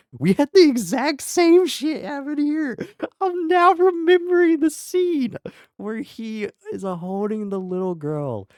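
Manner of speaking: high, loud crying outburst; anguished cry. Real, not acted. Streamer wailing at a game ending that hurt. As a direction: wail it out in frustration.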